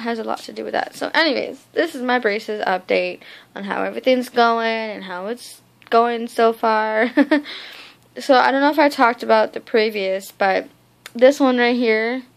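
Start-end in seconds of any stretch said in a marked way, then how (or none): 5.9-12.2 pulling cheek aside; talking with stretched mouth; finger in mouth. Real, not acted. Seen 0: Finger hooks inside cheek showing braces, then only touches lip.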